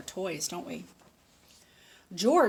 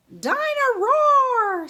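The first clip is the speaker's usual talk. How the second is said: put-on excited toddler squeal; 12 dB louder, much slower than usual. George squeals over toy.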